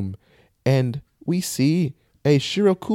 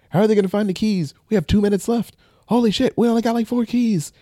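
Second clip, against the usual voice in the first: high, fast, panicky counting; panicked rush; 9 semitones higher, faster than usual. Retold or imitated. Players racing the clock, panicked high and fast. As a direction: race through it like time is running out.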